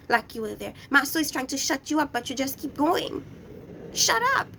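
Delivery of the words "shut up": high, loud snap; sharp shout. Real, not acted. Recapper yells at Letitia to shut up. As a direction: cut her off sharply. Scolding cast member on screen.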